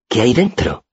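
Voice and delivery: high, curious little voice; Auri's voice. A narrator's reading. Girl sniffs the wine and asks about it, high curiosity.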